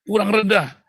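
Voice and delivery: high, loud push-up trainer's order; barked correction. Acted. Trainer to man doing push-ups, loud command. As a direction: call it out like a drill coach. Acts out trainer pushing him lower.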